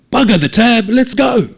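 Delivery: high, loud call; beckoning mates loudly. A narrator's reading. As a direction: call the boys from outside. Calling mates through window.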